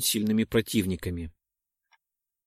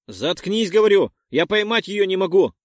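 louder, higher frustrated shouting; angry yell; 7 semitones higher, 6 dB louder than usual. Acted. Perk shouts at panicking Dex, angry yell. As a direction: shout him down while struggling.

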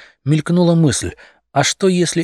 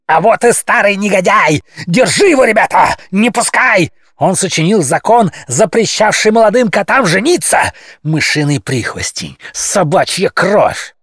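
loud, much higher screaming; yelling; 7 semitones higher, 4 dB louder than usual. A narrator's reading. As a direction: howl accusations at the old sage. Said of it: "завопил".

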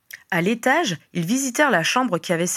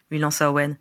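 ordinary delivery, nothing marked

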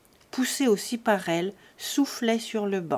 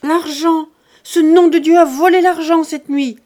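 very loud, much higher cry; howl of grief; 7 semitones higher, 12 dB louder than usual. A narrator's reading. Lise finding the empty drawer, howling in anguish. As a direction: wail over the stolen money. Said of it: "jeta un hurlement de douleur".

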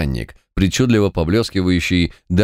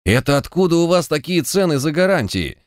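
high-pitched outraged question; indignant; 9 semitones higher than usual. A narrator's reading. Sapper challenges the guarantor's prices, indignant. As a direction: demand an explanation, voice raised in pitch only. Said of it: "Сапёр возмущался так".

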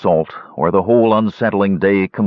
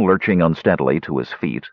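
usual speaking voice

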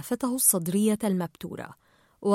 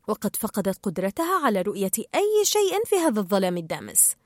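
usual speaking voice

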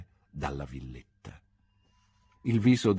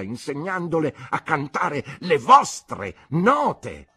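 aunt's indignant accusation; 6 semitones higher, 3 dB louder than usual. Acted. Aunt accuses Mr Marston.

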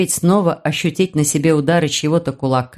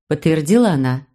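ordinary delivery, nothing marked

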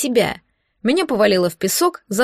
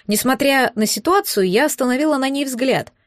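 ordinary delivery

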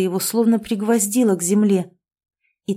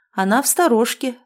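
ordinary delivery, nothing marked

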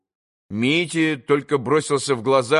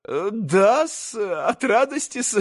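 higher, slow, ingratiating; fawning old sycophant; 6 semitones higher, slower than usual. A narrator's reading. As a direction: simper it out. Maximov's fawning voice.

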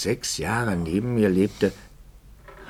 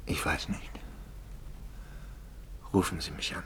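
tonlos und viel leiser geflüstert; 12 dB quieter than usual. Acted. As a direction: resigniert hinhauchen. Resigniertes Ende des Gesprächs. (müdes Flüstern)

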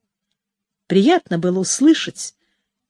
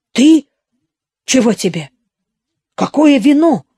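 abruptly woken, gruff and louder; groggy grumble; 3 semitones higher, 4 dB louder than usual. A narrator's reading. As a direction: grumble like a man shaken awake.

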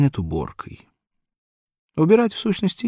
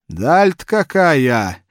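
put-on slow, groaning old man; grunting with effort; 7 semitones higher, 8 dB louder, slower than usual. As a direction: grunt it out as he sits. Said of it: "говорит он кряхтя".

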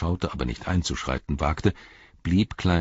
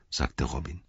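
ordinary delivery

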